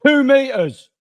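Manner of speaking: high, loud imitation of a scream; retold scream. Man in the store screamed at me, imitated high yell. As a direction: yell it out like an outraged stranger. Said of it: "screamed at me".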